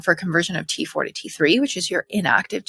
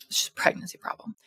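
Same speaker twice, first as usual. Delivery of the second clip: quick whisper; 9 dB quieter, faster than usual. Real, not acted. Whispered aside about breathlessness.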